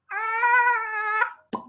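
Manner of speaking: long, high-pitched squeal; delighted squeal. Real, not acted. Host overjoyed at Mimi's lipstick gift, high squeal.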